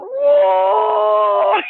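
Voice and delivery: high, loud drawn-out mock howl; imitated Bigfoot scream. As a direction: mimic the furious creature screaming at the light. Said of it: "He kept screaming".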